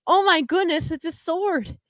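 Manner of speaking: high-pitched gasping wonder, played up; hammed-up kiddish amazement; mock amazed kids. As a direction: imitate kids marveling at a find. Kids marvel at finding sword.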